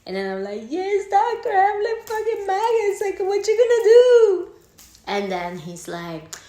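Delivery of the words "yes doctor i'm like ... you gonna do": very high, loud mock-hysterical shriek; hysterical plea. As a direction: shriek it at the doctor in panic.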